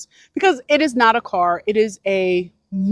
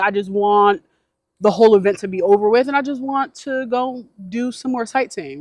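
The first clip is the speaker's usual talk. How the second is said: usual speaking voice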